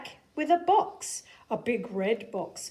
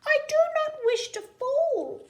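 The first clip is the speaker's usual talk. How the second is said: fish character voice; 4 dB louder than usual. Acted. Fish held up high begs the cat, high and loud. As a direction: wail it from up in the air. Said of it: "said the fish".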